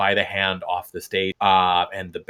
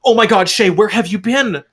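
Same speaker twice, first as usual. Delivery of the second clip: higher, louder, gasping with joy; breathless delight; 12 semitones higher, 8 dB louder than usual. Acted. Fable breaking from the kiss, overjoyed to see Shay. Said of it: "gasps for breath for a moment".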